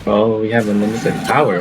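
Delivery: ordinary delivery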